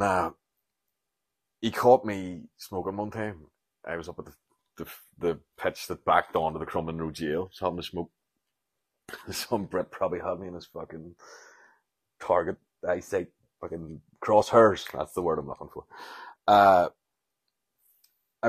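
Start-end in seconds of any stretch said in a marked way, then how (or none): none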